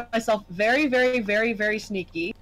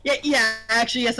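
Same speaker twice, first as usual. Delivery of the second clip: usual speaking voice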